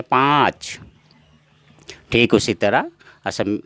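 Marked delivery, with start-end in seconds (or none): 0.0-0.8 पाँच with a nasalised long aa, through the nose; nasal vowel. Shown as a demonstration. Teacher demonstrates chandrabindu nasal vowel to students. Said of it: "इसको नाक से हम उच्चारण करेंगे".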